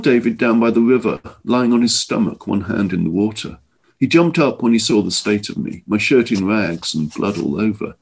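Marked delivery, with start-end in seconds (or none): none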